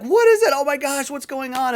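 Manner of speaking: high, panicked burst, mimicking frightened men; freaking out. Disciples seeing someone walk on the water, acted freak-out. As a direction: imitate a panicked crowd, starting loud and very high. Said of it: "they're freaking out".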